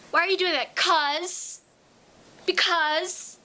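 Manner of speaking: put-on high, loud childish voice; little kid. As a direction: answer back like a stubborn small child. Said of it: "remember when we were little".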